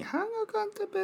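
put-on very high, childlike title call; child's voice. Imitating a child saying the show title, very high. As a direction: squeak it out like a small kid. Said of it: "子供の声で、".

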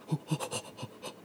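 quick voiceless gasps; short, soft puffs of flustered breath; panicked panting. Retold or imitated. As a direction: hyperventilate in mock panic. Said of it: "こんな感じでしたね".

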